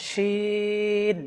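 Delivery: শিন drawn out long with a full, heavy sound; heavy shin. Shown as a demonstration. Teacher showing viewers how to say শিন, drawn out. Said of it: "মোটা করে বলতে হবে".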